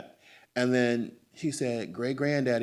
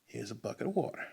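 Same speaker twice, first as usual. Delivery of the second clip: a touch of secretive hush; hushed conspiring father; 6 dB quieter than usual. Retold or imitated. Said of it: "He said".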